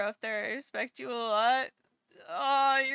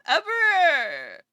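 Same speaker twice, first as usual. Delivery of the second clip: louder, voice catching on a cry; tearful denial; 4 semitones higher, 8 dB louder than usual. Real, not acted. Streamer claiming she is never emotional, tearful. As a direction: insist through a sob.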